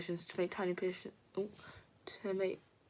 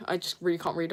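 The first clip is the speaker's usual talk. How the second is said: ordinary talk, nothing marked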